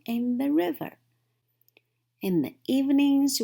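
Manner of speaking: high, loud, slowing at the stars; eager daydream. A narrator's reading. Frog describing spring fun to Toad, eager then slowing. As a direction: sell the dream of summer.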